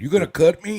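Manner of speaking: loud, high-pitched retold disbelief; mock alarm. Patient questions the doctor about cutting him, loudly alarmed.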